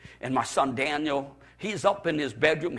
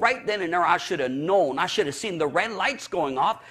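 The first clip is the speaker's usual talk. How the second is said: ordinary talk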